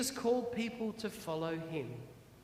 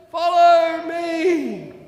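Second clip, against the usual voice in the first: slow, very loud, drawn-out shout; 8 semitones higher, 14 dB louder, much slower than usual. Acted. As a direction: shout back to the car falling behind. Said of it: "Old Mister T shouted".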